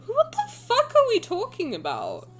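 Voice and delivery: slow, high-pitched bewilderment; baffled outburst. Real, not acted. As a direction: ask it in high, drawn-out disbelief.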